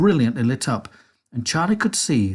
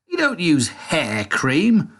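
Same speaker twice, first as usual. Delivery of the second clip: put-on slow, higher, scornful boy; scoffing; 5 semitones higher, 3 dB louder, slower than usual. A narrator's reading. Mike scoffs at Wonka, slow and disbelieving. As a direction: drag it out in disbelief.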